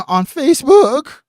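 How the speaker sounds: high, loud voice crack on Facebook. Real, not acted. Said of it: "Sound like my fucking little pubescent son".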